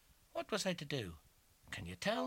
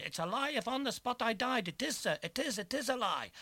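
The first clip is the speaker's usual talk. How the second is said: high, loud outburst; a desperate shout, pitched high; crying out; 11 semitones higher, 4 dB louder than usual. A narrator's reading. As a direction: fling the denial out loud. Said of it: "I cried".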